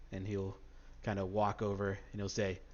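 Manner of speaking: ordinary delivery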